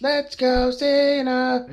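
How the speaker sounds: high-pitched mock chant of a lone kid; imitated kid's shout. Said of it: "He was very loud".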